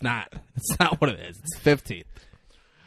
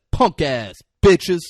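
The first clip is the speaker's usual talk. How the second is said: slow tough rapper; 3 semitones higher, 8 dB louder, slower than usual. Retold or imitated. Rapper line in video.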